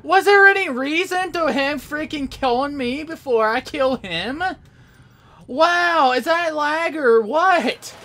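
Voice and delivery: high, loud, squealing protest; outraged disbelief. Real, not acted. Player killed before he could shoot, outraged disbelief. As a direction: shout it as a high, incredulous complaint.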